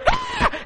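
very high startled cry; mock shriek. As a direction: yelp in mock alarm. Reacting to photo.

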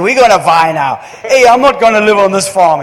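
loud, lively imitated Indian English accent; Indian dialect. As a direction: mimic an Indian storyteller with a loud, playful Indian accent. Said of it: "but in the Indian dialect".

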